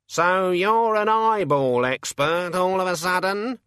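high, slow, mocking bird voice; sarcastic raven. A narrator's reading. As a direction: needle her as a smug raven.

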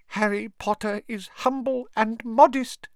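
high, awed house-elf voice; reverent elf. A narrator's reading. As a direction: speak with squeaky reverence. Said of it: "said Dobby reverently".

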